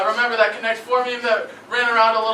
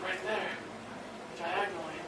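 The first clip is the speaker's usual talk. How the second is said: much softer, lower deadpan; quiet deadpan; 6 semitones lower, 19 dB quieter than usual. Pretend. Quoting the sister to his friend, soft and low deadpan. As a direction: drop it soft and low like a punchline. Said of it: "And I say".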